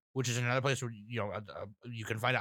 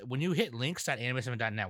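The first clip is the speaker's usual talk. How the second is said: normal talking voice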